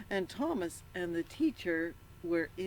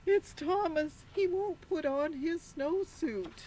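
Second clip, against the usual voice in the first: high, fretful complaint; upset teacher; 10 semitones higher than usual. Acted. Teacher complains to the principal about Thomas, high and fretful. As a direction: tattle on the child in a fluster.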